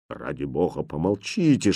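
low, soft urgent aside; hushed plea. A narrator's reading. Governess hushing the princess, near whisper. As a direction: beg for silence under your breath. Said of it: "шепнула гофмистерина".

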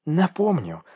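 high, indignant accusation; furious exclamation. A narrator's reading. Dasha throws his crime back at Egor, furious. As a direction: accuse him with rising fury. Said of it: "воскликнула девушка яростно".

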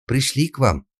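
urgent maid repeating herself; insistent. A narrator's reading. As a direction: press the point firmly. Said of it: "повторила девушка настойчиво".

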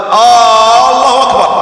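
"آه، الله أكبر" with a long, loud, high "aa" on the first vowel; drawn-out opening hamza. Shown as a demonstration. Teacher showing students an invalid takbir, first vowel stretched long. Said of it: "لأن هذا استفهام".

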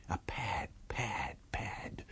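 breathy whispered footfalls. A narrator's reading. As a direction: hush it like padding feet. Footsteps rushing past unseen.

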